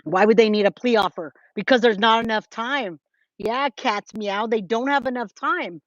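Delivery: normal talking voice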